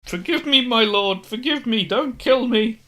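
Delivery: high, loud terrified pleading; begging for mercy. Acted. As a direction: grovel for your life. Servant begs the lord.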